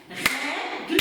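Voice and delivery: règle split into two beats, "rè-gle"; segmented syllables. Shown as a demonstration. Teacher segmenting règle with pupils, syllable by syllable. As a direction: break règle after a held open "è". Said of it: "segmentons-nous ensemble le règle".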